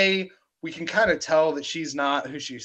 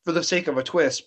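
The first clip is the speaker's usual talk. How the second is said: normal talking voice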